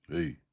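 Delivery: loud beastly grunt; grunted hey. A narrator's reading. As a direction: bark it out from the dark. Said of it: "came out of the lump".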